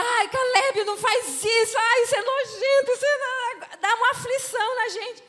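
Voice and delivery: high, loud, laughing "ew" at the gross face; disgusted squeal. Retold or imitated. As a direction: squeal at him to stop. Wives squealing at gross trick.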